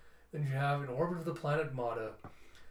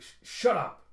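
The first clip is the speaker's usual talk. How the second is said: stammer, then higher, louder burst; angry outburst; 6 semitones higher, 8 dB louder than usual. Acted. Gun holder silencing the pleading captive, angry. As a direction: cut them off with an acted shout. Telsa threatens the pleading Quan.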